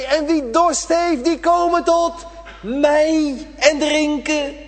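high, loud, slow proclaiming cry; Jesus on the temple square cries out to the thirsty, high and loud. Acted. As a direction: call out over the temple square. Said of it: "dat Jezus schreeuwt".

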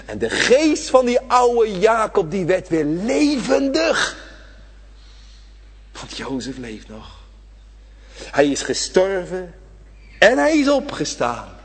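loud, high, slow preacher's climb; full-force preaching. Acted. Preacher urging the congregation not to forget, at full force. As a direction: drive it home from the pulpit, stretching each word.

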